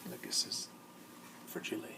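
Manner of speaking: hushed unvoiced aside; a secret whisper about the fragile box. Shown as a demonstration. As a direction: whisper it to the little ones. Reading label aside to children.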